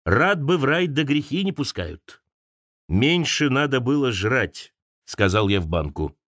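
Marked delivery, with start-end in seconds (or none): none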